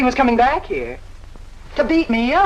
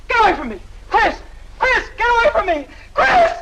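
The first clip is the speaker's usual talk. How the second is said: terrified screams; 11 semitones higher than usual. Acted. Kitty screaming as the suitor attacks her, terrified. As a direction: scream for your life.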